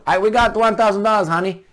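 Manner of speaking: high, loud bragging to his wife; mock boastful husband. A husband bragging to his wife about their money, loud and high. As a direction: brag like a fool about a thousand dollars.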